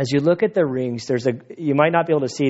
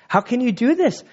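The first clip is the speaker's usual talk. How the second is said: incredulous; 10 semitones higher than usual. Real, not acted. Exclaiming at the destruction.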